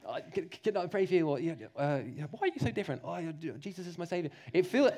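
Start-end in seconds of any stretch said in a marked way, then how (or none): 0.1-1.3 hesitant, stammered offer; awkward mumble. Retold or imitated. Preacher imitating a teen's awkward offer to pray. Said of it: "awkward words". 1.4-4.3 softer, rushed embarrassed mumble; awkward mumble. Retold or imitated. Preacher imitating a teen fumbling about Jesus, softer. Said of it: "awkward words".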